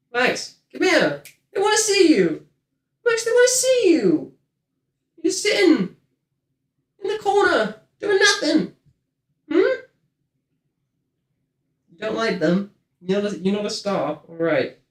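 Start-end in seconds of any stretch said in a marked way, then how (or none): 0.1-9.8 put-on much higher sing-song baby voice; pet baby talk. Owner coaxes the cat to the camera in high baby talk.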